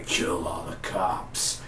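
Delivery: breathy, slow whispered command; mock villain whisper, slow; whispered smoker's voice. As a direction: breathe the order out in a hoarse whisper. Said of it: "Sound like a smoker's voice".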